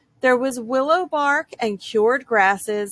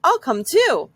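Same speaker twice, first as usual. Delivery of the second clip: high, eager little rabbit; bright little bunny voice; 7 semitones higher, 4 dB louder than usual. A narrator's reading. Rabbit character voice.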